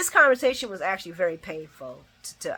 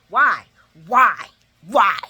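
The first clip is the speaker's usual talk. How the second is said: louder, hammed-up furious why, each one punched; imitating angry shout; 10 dB louder, slower than usual. Dante demanding answers from his father, imitated loud furious why. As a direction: retell Dante's fury as a loud repeated demand. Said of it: "He was pissed".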